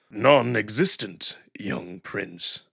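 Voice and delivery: slow, low and dismissive; cold old wolf. Acted. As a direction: answer with icy contempt. Cato answers Neferu coldly.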